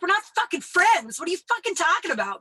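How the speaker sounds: high, loud, quick indignant retort; mock outrage. Telling off a guy friend for saying friends, mock outrage. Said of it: "I got mad at him".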